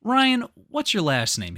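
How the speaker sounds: high, loud sharp host question; Chris Hansen questioning. Retold or imitated. Host grilling the caught man. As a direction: press him like the TV host. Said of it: "he goes".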